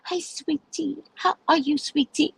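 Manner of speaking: high, sugary imitation of an older woman; mock-sweet motherly greeting. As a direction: greet her like your own daughter. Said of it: "talks to me like I'm her daughter".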